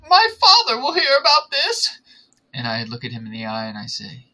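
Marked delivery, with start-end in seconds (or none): 0.1-2.0 high, loud, hammed-up whine; Draco Malfoy. Draco answering a public proposal, mock outraged. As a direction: cry it out as an outraged brat. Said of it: "And he says".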